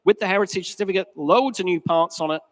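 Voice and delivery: normal talking voice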